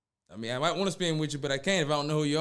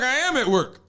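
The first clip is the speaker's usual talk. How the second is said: smug retort; 5 semitones higher, 5 dB louder, slower than usual. Retold or imitated. Boyfriend's retort to cheater.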